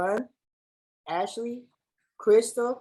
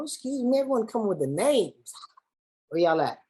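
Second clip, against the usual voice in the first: normal talking voice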